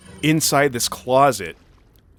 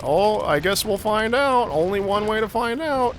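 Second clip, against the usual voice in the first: very high, put-on squeaky commentary; mock panic; 10 semitones higher than usual. Player narrating a boulder chase to themselves, mock-panicked high voice. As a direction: play at fretting in a silly falsetto.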